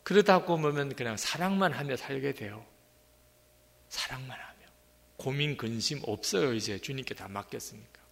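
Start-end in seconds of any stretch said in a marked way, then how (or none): 3.9-4.7 breathy whispered repeat; a hushed whispered echo. Real, not acted. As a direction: whisper it back softly. Echoes phrase in a whisper.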